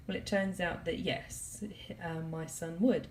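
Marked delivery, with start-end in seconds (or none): none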